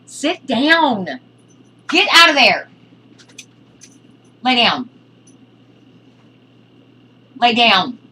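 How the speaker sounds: loud, high, sharp commands at the cat; scolding the cat. Real, not acted. Owner yelling at her cat on the desk, loud commands. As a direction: order the cat down, loud and fed up.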